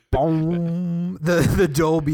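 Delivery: mouthed movie boom. Retold or imitated. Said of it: "THX sound".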